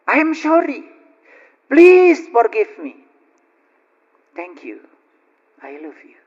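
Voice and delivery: starts high, then sinks to near-silent softness; heartfelt recitation. Shown as a demonstration. Preacher demonstrates Ho'oponopono to congregation, fading to a hush. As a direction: let the mantra fade into a hush.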